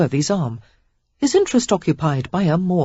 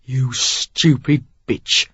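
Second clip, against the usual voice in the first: slow, low, full of hatred; low hateful; 3 semitones lower, much slower than usual. A narrator's reading. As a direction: spit it with hatred. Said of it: "low and filled with hatred".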